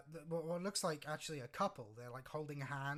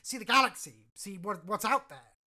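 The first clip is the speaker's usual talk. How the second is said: higher, louder, eager declaration; earnest plea, punched and raised; newly confident villager; 4 semitones higher, 13 dB louder than usual. Acted. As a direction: step up and make your case. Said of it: "she sort of gets more confident".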